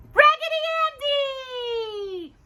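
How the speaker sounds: high, slow cry of delight; delighted discovery. Acted. Parent reading to child, crying out the doll's name in delight. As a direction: squeal the name like finding a lost friend. Doll found in the package.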